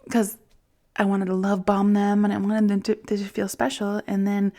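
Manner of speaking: ordinary talk, nothing marked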